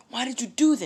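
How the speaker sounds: high, loud outraged yell; shouted accusation at the friend; angry outburst. Acted. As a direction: demand an answer, shouting. Deku thinks he was punched.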